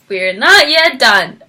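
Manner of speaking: normal talking voice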